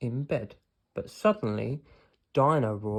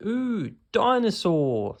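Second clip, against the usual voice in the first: put-on childlike wonder; 9 semitones higher, 7 dB louder, slower than usual. A narrator's reading. George marvels at shop window.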